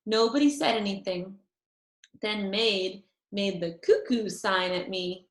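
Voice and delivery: usual speaking voice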